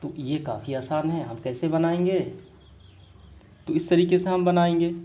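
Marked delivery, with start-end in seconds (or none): none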